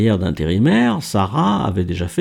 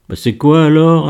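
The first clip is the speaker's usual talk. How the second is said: pressing question, pitched up; insistent; 5 semitones higher, 4 dB louder than usual. A narrator's reading. Miguel pushes Kader to reveal his secret, insistent. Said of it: "insista Miguel".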